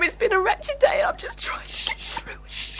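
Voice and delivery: exhausted weeping whisper to Kafka; tearful whisper. Acted. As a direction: whisper it, worn out from crying. Crying assistant sinks to whisper.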